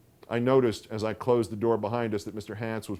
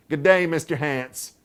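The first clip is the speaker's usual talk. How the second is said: grim; 6 semitones higher, 7 dB louder than usual. A narrator's reading. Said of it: "her tone was as grim".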